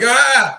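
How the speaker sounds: sudden high startled yelp; panicked yelp. Retold or imitated. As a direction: shriek as the clip ends. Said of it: "I'm like".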